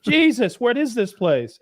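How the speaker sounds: high exasperated exclamation; mock outraged bewilderment; appalled Englishman. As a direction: give up in disbelief, acted.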